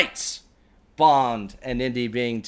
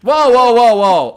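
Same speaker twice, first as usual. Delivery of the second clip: whoa whoa, higher and much louder; loud protest; 11 semitones higher, 13 dB louder, slower than usual. Real, not acted. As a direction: shout him down in mock outrage. Protesting friend's claim.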